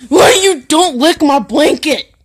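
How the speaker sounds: loud, high, slow scolding; exasperated, drawn-out telling-off; scolding the dog. Real, not acted.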